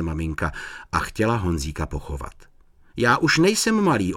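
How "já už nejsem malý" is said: higher-pitched, resolute protest; determined. A narrator's reading. Honzík tells mum he is not small, determined. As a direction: insist like a determined little boy. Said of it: "odpověděl rozhodným hlasem Honzík".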